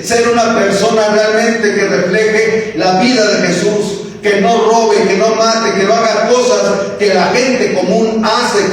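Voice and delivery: high, driving pulpit exhortation, each "no" hammered; rally the church to be different; preaching at full force. Acted. Preacher charging the congregation to be different, full force.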